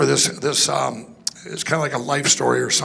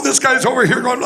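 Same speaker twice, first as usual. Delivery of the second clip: usual speaking voice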